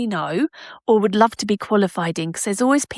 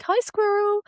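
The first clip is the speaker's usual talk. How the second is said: talking to squirrel; 11 semitones higher, 3 dB quieter than usual. Real, not acted. Greeting squirrel outside patio doors.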